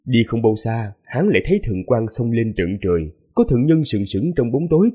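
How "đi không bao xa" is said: ordinary delivery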